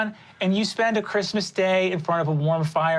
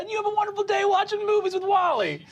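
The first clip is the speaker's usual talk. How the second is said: Wally voice; 13 semitones higher, faster than usual. Acted. GM voices Wally, high and fast.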